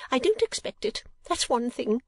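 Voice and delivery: high, self-pitying whimper; aggrieved, martyred mother. A narrator's reading. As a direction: sulk in a high lady's voice. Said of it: "whimpered Missus Nickleby".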